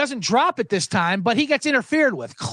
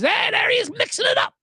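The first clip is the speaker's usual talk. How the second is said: mock announcer; 14 semitones higher than usual. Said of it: "those guys are thinking".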